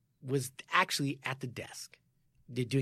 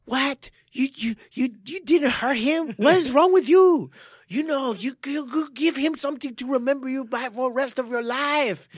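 high, loud incredulous coach; loud high outraged mentor; outraged old-school coach; 10 semitones higher, 8 dB louder than usual. Retold or imitated. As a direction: scold like an old-school coach who can't believe it. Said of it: "Marcus, he's like".

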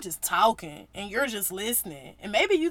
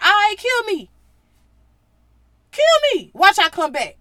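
much louder, higher defiant dare; defiant shout; 9 semitones higher, 11 dB louder than usual. Retold or imitated. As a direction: yell the dare at them, cocky. Jesus daring them, voiced.

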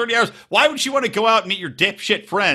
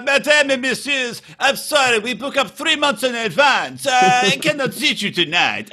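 high, put-on restaurant host voice; hammed-up foreign head waiter, pitched high; mock maître d'; 7 semitones higher than usual. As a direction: play a fussy maître d' turning guests away. Mimicking a haughty restaurant host.

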